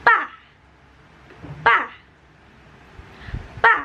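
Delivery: pa dropping sharply; fourth tone, falling. Shown as a demonstration. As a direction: drop pa hard from high. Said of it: "B A dibacanya jadi". (nada keempat, pa turun)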